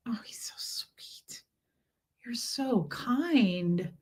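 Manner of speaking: soft whispered cooing, slow. Real, not acted. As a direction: whisper tenderly to a sweet kitten. Cooing over the kitten.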